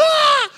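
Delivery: sudden high loud "Ah!" gasp; a yelp of shock at the bank balance. Retold or imitated. Wife seeing the bank account, high startled cry. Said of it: "looks at the bank account and goes".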